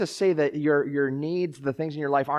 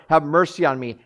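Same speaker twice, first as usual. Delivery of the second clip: louder, pleading cry; a louder cry for mercy; 3 semitones higher, 8 dB louder than usual. Shown as a demonstration. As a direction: call out to God with more force. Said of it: "and louder".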